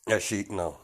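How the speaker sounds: ordinary delivery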